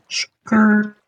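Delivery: high mock drift screech "Skirt"; tire screech imitation. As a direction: squeal it like tires drifting. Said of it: "It's like Tokyo Drift, you just go".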